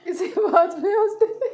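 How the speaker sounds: laughing plea to the crying co-wife to smile; laughing coax. Real, not acted. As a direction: laugh her into laughing along. Coaxing her to laugh.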